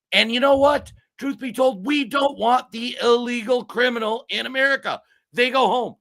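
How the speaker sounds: high and loud, a heated outburst; really worked up, nearly yelling; fired up. Real, not acted. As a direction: passionate rant at full volume. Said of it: "I'm a little fired up".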